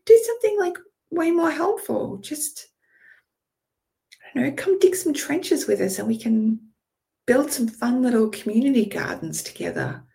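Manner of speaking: high, bubbly fairy chatter; cheery fairy voice. Acted. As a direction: chirp it like an excited fairy. Speaker voices fairies to troll.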